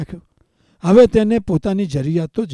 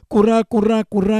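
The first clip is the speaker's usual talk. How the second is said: mock newborn crying kurra kurra, raised and louder; imitating a baby's wail; 4 semitones higher, 4 dB louder, faster than usual. Teacher imitating a newborn for meditators: a mild mock wail.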